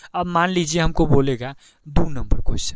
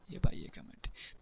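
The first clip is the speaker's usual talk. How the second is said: whispered repeat; 17 dB quieter than usual. Shown as a demonstration. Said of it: "A by ए का matrix क्या बोल रहा है".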